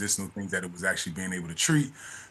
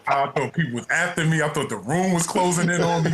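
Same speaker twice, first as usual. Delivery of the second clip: usual speaking voice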